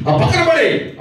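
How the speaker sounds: high, loud barked command; sudden high shout; shouted order. Retold or imitated. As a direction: yell at her to drop it. Ordering her to throw it away.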